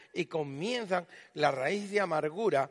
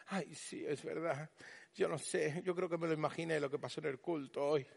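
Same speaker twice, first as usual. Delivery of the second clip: desanimado en voz baja; 3 semitones lower, 10 dB quieter than usual. Retold or imitated. Creyente ya desanimado.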